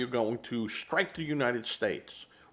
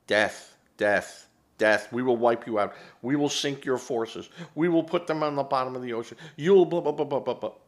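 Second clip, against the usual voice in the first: louder, ranting mock chant of threats; mock Iranian threats; 4 semitones higher, 5 dB louder than usual. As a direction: yap it out like a yapping dog. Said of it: "Like a yiping dog".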